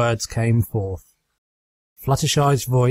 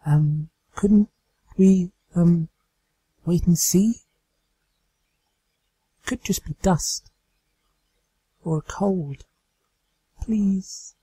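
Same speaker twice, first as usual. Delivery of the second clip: timid whisper; 7 semitones higher than usual. Acted. Fluttershy pleads with the trapped friends, whispering. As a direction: plead gently, barely above a whisper. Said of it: "Fluttershy's whisper carried well".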